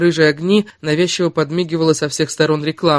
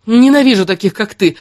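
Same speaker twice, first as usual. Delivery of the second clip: much louder and higher burst; blurted hate; 6 semitones higher, 8 dB louder than usual. A narrator's reading. Kira blurts hatred at Erik, loud and higher. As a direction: spit it out at him. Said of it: "выпалила она".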